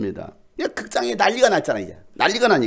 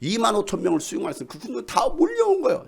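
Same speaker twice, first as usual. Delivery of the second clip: ordinary talk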